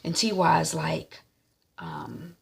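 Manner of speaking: ordinary delivery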